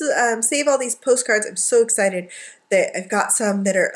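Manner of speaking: ordinary talk, nothing marked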